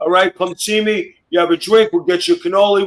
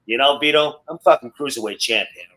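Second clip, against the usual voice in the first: lightly imitated self-important wrestler, lower pitch; low, softer mock boast; Nunzio; 5 semitones lower, 4 dB quieter than usual. Bragging to Vito in the office, lightly imitated self-importance. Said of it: "Nunzio, this fucking guy".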